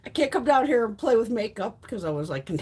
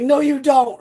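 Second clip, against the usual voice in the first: loud, high imitated scolding; mom's firm refusal; 7 semitones higher, 12 dB louder, slower than usual. Mom refusing daughter a dog, loud imitated scolding. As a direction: shut it down as an exasperated mom.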